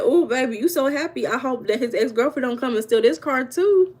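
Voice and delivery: sweet on top, shady underneath; sarcastic sweet. Retold or imitated. Shady sweet talk to the neighbor about the car. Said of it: "I said".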